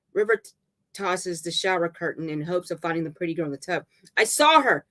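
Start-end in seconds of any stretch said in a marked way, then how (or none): 4.2-4.9 high, loud insistence; hysterical cry. Acted. River searching the tub, hysterical high cry. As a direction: cry it out in panic.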